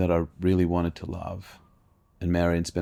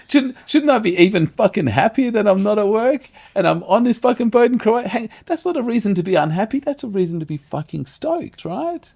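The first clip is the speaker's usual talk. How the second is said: much higher, much louder, near-shouted tirade; exasperated rant; 14 semitones higher, 8 dB louder than usual. Retold or imitated. On honeymoon boat, yelling at his inner voice, exasperated. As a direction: blow up at the voice in your head.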